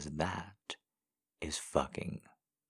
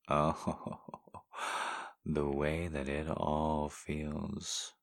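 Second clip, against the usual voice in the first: normal talking voice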